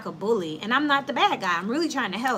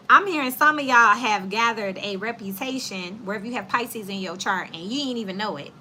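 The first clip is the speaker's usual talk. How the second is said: normal talking voice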